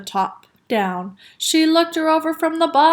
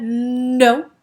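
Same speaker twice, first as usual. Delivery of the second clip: drawn-out "nnno"; stubborn refusal; 4 dB louder than usual. A narrator's reading. Father arguing with cashier, stubborn. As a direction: dig in on a long first consonant.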